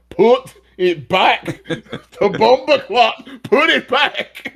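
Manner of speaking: laughing, slow, high mock Jamaican accent; hammed-up Jamaican patois through laughter. Imitating a Jamaican voice telling someone to return the doll, laughing Jamaican accent. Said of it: "thinking this in, like, a Jamaican accent".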